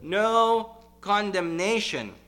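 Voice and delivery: "No" held long, high and loud, then "condemnation"; emphatic NO. Real, not acted. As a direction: hit "No" hard and slow. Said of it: "translates to an emphatic no".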